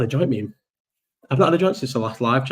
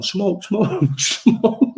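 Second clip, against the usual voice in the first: high, pleading call of smoke, rising to a breathy laugh; imitating the Spanish homeless guy asking for a smoke, high and pleading; homeless guy begging; 9 semitones higher, much slower than usual. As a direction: mimic a beggar asking for a smoke, pitched up. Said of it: "some Spanish homeless guy has gone".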